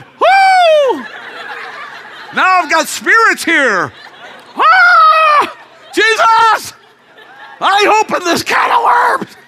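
high, loud alarmed shouts with laughter; laughing, flustered shouts of alarm; panicked yelling. Retold or imitated. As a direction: yell in mock panic at the shock. Panicked reaction to lifted dress.

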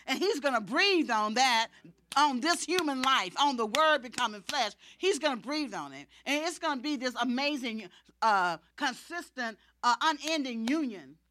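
loud, driving sermon delivery; forceful, insistent pulpit voice; full-force preaching. Acted. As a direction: preach it with rising urgency. Preaches in Jesus's voice.

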